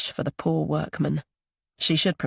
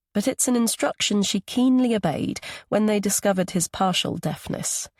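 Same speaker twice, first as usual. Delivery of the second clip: ordinary delivery, nothing marked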